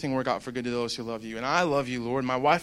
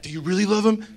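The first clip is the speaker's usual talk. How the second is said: taunting enemy; 5 semitones higher, 6 dB louder than usual. Retold or imitated. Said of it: "the enemy, he was, like, putting in my head".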